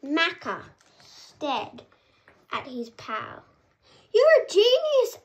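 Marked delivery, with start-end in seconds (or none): 4.1-5.2 high, loud burst of delight; thrilled cry to his best pal; excited realisation. Acted. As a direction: shout it like a sudden brilliant idea. Maca's excited discovery.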